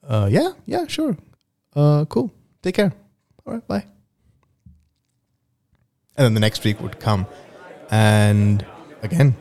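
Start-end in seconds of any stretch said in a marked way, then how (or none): 0.1-3.8 Anna's voice. Acted. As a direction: play shy Anna, pitched up. Acted dialogue, shy goodbye.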